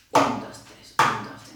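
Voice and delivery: whispered counting in strict time; counting subdivisions. Shown as a demonstration. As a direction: tick off un dos tres like a metronome. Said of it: "estemos siempre subdividiendo".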